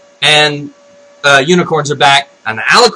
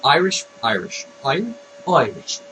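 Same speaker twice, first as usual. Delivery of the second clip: low, soft tries at an Irish accent; practising Irish; 4 semitones lower, 10 dB quieter than usual. Shown as a demonstration. Vlogger warming up for the Irish voice, quietly practising. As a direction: feel out the Irish accent under your breath. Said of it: "I can't do the Irish voice".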